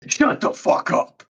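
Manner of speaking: slow, voiceless imitation of a possessed girl; drawn-out demonic whisper, acted; possessed snarl. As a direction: hiss it out slowly, like something possessed. Possessed girl snaps at narrator.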